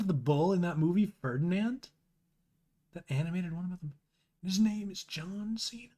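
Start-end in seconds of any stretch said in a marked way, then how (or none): 2.9-5.9 whisper. Real, not acted. Whispering the punchline.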